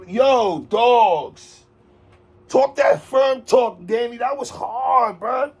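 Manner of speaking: high, slow, drawn-out hype; hyped praise. Real, not acted. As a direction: savour the bar like a fan.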